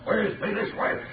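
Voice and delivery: ordinary talk, nothing marked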